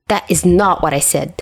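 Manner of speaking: plain reading voice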